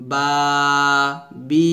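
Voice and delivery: বা and বি each with a stretched vowel; separate, lengthened বা, বি; madd demo. Shown as a demonstration. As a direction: hold the vowels of বা, বি evenly. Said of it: "এক লিপ টেনে পড়তে হয়".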